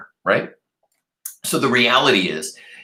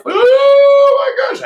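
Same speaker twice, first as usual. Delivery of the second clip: much higher, louder, slow squeal; shrieking alarm; 10 dB louder, much slower than usual. Retold or imitated. Remembering being lost as a small child, squealing.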